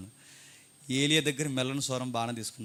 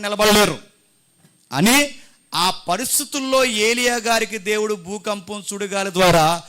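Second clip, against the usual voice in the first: forceful, drawn-out pulpit explanation; emphatic preaching; 5 semitones higher, 8 dB louder, slower than usual. Real, not acted. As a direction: hammer the meaning home to the congregation. (ఆవేశంగా వివరించే ప్రసంగం)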